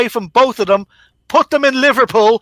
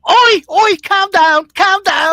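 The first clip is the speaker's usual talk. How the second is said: much higher and louder, a hammed-up Liverpool "calm down, calm down"; mock Scouser; 10 semitones higher, 4 dB louder, slower than usual. A panelist mocking his heckler as a Scouser, hammed-up high voice. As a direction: ham up a jokey Scouse hush, pitched high.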